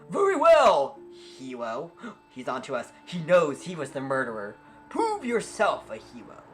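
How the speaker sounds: high, loud proclamation; lofty captain voice; grand captain. Acted. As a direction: address the hero with pomp. Captain NPC gives quest.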